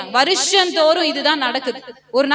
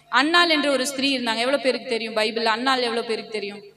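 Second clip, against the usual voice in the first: normal talking voice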